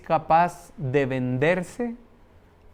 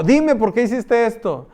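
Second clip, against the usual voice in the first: angry demand; 6 semitones higher, 6 dB louder than usual. Retold or imitated. Mother demanding an explanation.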